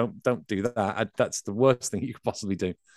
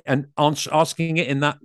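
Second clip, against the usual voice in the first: ordinary talk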